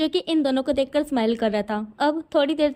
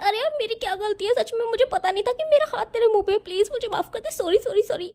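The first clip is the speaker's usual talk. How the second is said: high, fast begging while being pulled by the hair; high pleading, apologizing over and over; 8 semitones higher than usual. Acted. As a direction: whine out the sorries to get let go.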